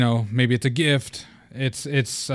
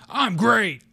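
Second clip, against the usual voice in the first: much higher, roughed-up wrestler voice; Hulk Hogan impression; 10 semitones higher, much slower than usual. Shown as a demonstration. Host shows listeners how to answer like a wrestler. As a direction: bellow it like a pro wrestler. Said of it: "Do it in a Hulk Hogan impression".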